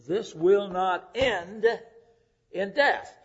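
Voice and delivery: drawn out slowly, each word weighed; slow, solemn quote of Jesus; slow emphatic quote. Shown as a demonstration. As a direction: let it land slowly and firmly. Quotes Jesus, then repeats it.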